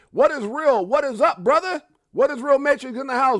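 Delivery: loud, high hype-man greeting; big, loud livestream welcome; hyped shout-out. Real, not acted. Calling out greetings to viewers.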